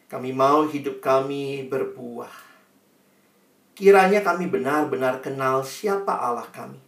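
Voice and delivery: measured prayer with reverent pauses; earnest prayer. Real, not acted. Preacher leads congregation in thanking God, earnest prayer. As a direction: address God humbly and earnestly.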